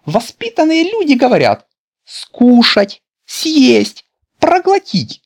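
very high, loud, primly correcting; a fussy grandmother teaching manners; prim old lady. A narrator's reading. (бабушка чопорно поучает, очень высоким голосом)

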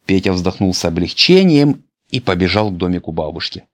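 ordinary delivery, nothing marked

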